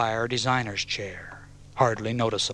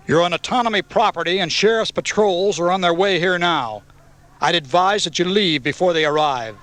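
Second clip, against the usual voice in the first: raised, higher, forceful warning; angry shout; 6 semitones higher, 5 dB louder than usual. A narrator's reading. Garth warning the bikers off the property, shouting angrily. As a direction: shout a stern order to clear out. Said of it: "Garth shouted angrily".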